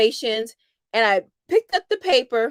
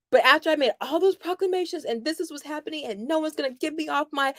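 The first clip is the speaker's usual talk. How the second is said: ordinary talk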